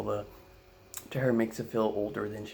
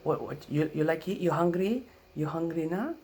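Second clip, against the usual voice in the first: high, louder broken-English questions; grandma's Filipino accent; 7 semitones higher, 4 dB louder than usual. Retold or imitated. Grandma offering breakfast to the kids, Filipino accent.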